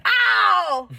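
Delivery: high mock "ow" of pain; sudden pained ow; imitated drunk yelp. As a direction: yelp like a drunk man hurting. Drunk dad groaning getting in car.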